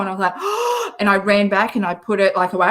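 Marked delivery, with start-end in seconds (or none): 0.4-1.0 sharp high gasp of fake horror; playful high-pitched gasp of shock; mock shocked gasp. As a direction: gasp as if betrayed. Shocked at kombucha reveal.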